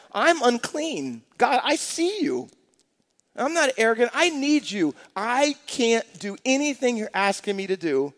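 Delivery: a lightly voiced humbled Isaiah; pleading prophet. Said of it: "he says about himself".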